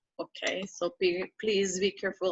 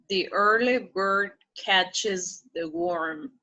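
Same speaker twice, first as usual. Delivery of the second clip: slow model reading; slower than usual. Shown as a demonstration. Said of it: "slow model reading".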